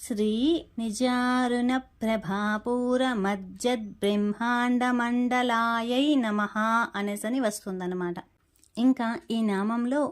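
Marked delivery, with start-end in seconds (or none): 0.0-6.5 slow, even chanting of the name with నమః; slow devotional chant; slow nāmāvaḷi recital. Shown as a demonstration. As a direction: lay out every syllable slowly, like temple recitation. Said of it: "అదే నామావళిలో వచ్చేసరికి".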